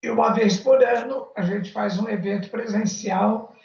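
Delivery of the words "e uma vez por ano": normal talking voice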